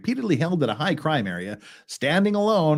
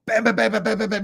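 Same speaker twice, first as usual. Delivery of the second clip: imitated gunshots; 6 semitones higher, faster than usual. Imitating gunfire in retelling.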